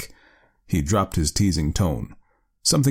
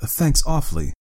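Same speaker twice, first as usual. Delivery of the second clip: higher-pitched, hesitant politeness; polite; 7 semitones higher than usual. A narrator's reading. Nephew thanking his aunt for wiring him, politely. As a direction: offer dutiful, polite thanks. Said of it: "he said politely".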